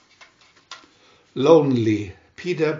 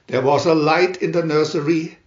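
plain reading voice

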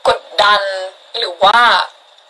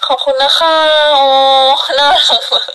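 excited thanks; 5 semitones higher than usual. Real, not acted. Thanking viewers for gifts.

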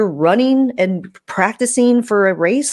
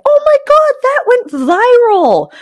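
high-pitched, louder gushing over a viral post; excited squeal; 7 dB louder than usual. Pretend. Creator imagining a viral post, high excited squeal. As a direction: squeal it like your post just blew up.

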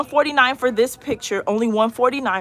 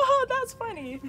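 laughing; 11 semitones higher than usual. Real, not acted. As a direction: say it through real giggles. Amused by a cat item.

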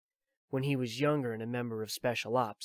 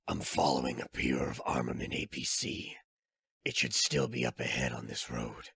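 put-on much lower, a weary old soldier; Snake's voice; 12 semitones lower than usual. Old soldier explains his pursuit to Drebin, low and weary. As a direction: drop into the tired veteran's low voice.

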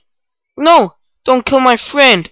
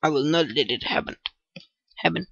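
very soft, lower, fast; soft lower rush of words; hushed mutter; 9 semitones lower, 14 dB quieter, faster than usual. Real, not acted. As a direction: mutter it under your breath. Muttered determination.

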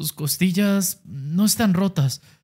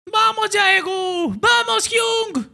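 ringside shout; much slower than usual. Acted. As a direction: yell across the ring to spur him on. Teammates shout encouragement ringside.

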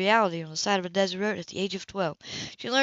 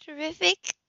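much higher, cheerful; lightly voiced woman, cheery; bright female voice; 9 semitones higher than usual. A narrator's reading. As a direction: toss it off high and bright. Woman replies high.

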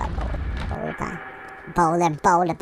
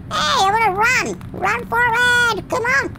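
pleading urging; 11 semitones higher than usual. Acted. Player urging the character to run forward, high pleading. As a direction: urge them on in a squeaky voice.

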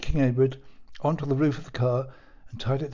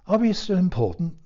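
concern; 5 semitones higher, 4 dB louder than usual. A narrator's reading. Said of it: "asked her ladyship with some concern".

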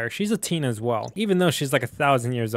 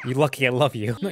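ordinary talk, nothing marked

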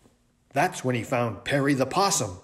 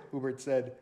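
ordinary delivery

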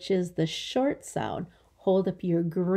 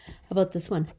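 normal talking voice

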